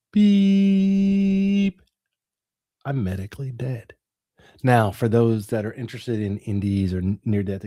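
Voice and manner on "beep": long, high, steady monitor tone; flatline beep imitation. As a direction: hold one high electronic beep like a flatline. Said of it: "And I hear".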